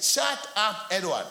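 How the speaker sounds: high, loud scolding imitation; mock shout; retold angry command. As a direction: act out an exasperated wife shutting him up. Retells wife's angry command.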